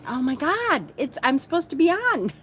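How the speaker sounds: high-pitched retold panic; mock alarm. Realising on the walk back she's late to go on, mock alarm. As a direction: re-enact the sudden realisation, pitch climbing.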